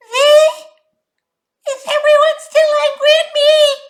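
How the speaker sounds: very high, loud whiny buzz; whining mosquito. Acted. Mosquito buzzes in people's ears, high whine.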